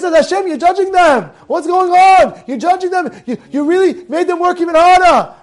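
high, loud accusing protest; acted remonstration, pressing each phrase; Moshe. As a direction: challenge the one in charge, indignantly. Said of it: "Moshe says".

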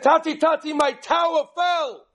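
put-on high, loud child's wail; little boy wailing to his father. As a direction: cry out like a small boy whose tower fell. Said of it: "He said".

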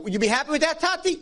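a touch of high eager pleading to his father; lightly voiced hopeful son; eager asking. Son asking his father, eager and high.

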